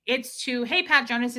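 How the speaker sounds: usual speaking voice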